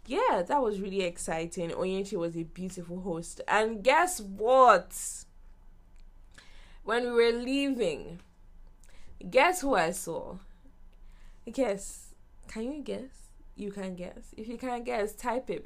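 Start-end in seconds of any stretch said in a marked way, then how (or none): 3.5-10.4 slow, loud teasing build-up; drawn-out teaser. Real, not acted. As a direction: stretch it out to hook the viewers. Teasing the audience.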